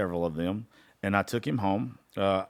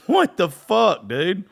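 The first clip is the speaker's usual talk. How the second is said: much higher and louder, drawn out yell; mock furious dad; 9 semitones higher, 6 dB louder, much slower than usual. Dad yelling at his son down the phone, mock furious peak. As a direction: let the outrage peak as he repeats himself. Dad repeats angry outburst.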